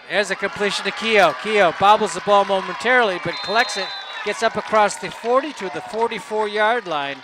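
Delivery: excited booth commentary; live play-by-play. Real, not acted. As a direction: call the completion as it unfolds.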